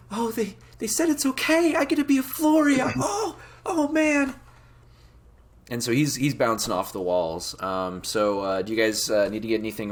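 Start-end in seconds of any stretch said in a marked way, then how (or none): 0.1-4.3 put-on high falsetto excited kid; thrilled little boy voice; overjoyed Tim. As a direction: burst with disbelieving joy, high-pitched. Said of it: "Tim is just overjoyed".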